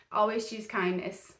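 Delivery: ordinary talk, nothing marked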